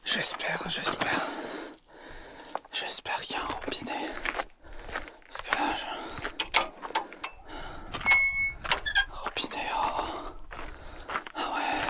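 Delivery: hushed unvoiced hoping whisper. Real, not acted. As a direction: whisper it, barely audible. Hoping for a water tap.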